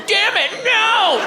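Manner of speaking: high, drawn-out cry of dismay; hammed-up alarmed scolding; mock panicked yell. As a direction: yell in comic horror to stop it. Imagined yell at vomiting child.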